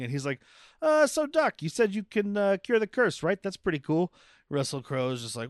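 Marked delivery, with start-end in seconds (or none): 0.8-4.1 high-pitched mock movie-star voice; Tom Cruise impression. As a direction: play him high, starting loud then easing. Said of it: "Tom Cruise and Russell Crowe talking".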